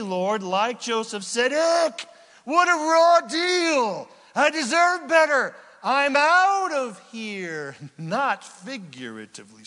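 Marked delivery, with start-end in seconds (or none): 1.5-7.7 much higher, louder hammed-up whine; whiny complaining wife. Phinehas' wife loudly whining that she deserves better. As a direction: ham up her indignant complaint.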